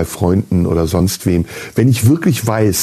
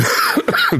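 sharp cough after swallowing wrong; choking cough. Real, not acted. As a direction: cough it up hard. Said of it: "ich habe mich verschluckt". (kräftiges Husten nach dem Verschlucken)

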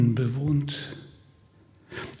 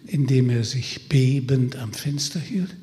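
plain reading voice

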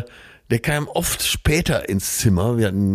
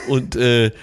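ordinary talk, nothing marked

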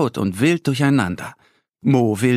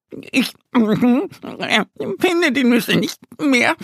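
much higher, hesitant squirrel stammer; stuttering squirrel; 12 semitones higher, slower than usual. A narrator's reading. The caught squirrel stammers at the birds, high stutter. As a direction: caught out, fumble for words. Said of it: "stammelt es".

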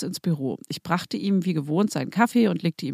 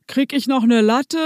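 mock-demanding boss voice, a little louder; boss quote; 4 semitones higher, 5 dB louder than usual. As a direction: toss off the order like a spoiled boss. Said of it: "So Zitate". (Chef imitiert, fordernd)

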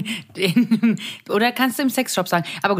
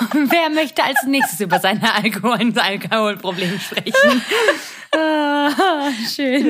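laughing; 2 semitones higher than usual. Pretend. Mock group therapy host.

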